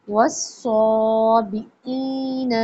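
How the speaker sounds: वस्साबिईन slow with a heavy स्वाद sound; heavy sad. Shown as a demonstration. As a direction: draw out वस्साबिईन slowly. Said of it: "सौ, स्वाद है".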